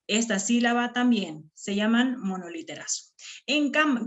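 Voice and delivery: normal talking voice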